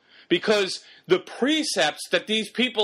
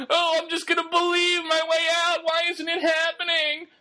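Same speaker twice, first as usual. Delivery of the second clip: high-pitched mock wail of a frustrated believer; mocking complaint; 8 semitones higher than usual. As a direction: imitate a believer protesting, voice held high throughout. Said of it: "We start saying".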